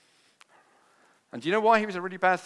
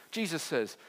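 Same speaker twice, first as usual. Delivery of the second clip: ordinary talk, nothing marked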